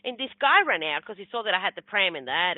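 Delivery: usual speaking voice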